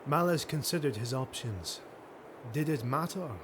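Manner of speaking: usual speaking voice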